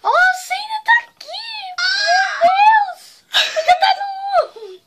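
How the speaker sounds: high squealing laugh-scream; shrieking laugh-cry. Real, not acted. Face burning, shrieks.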